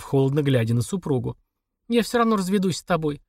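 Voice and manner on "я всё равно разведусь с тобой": plain reading voice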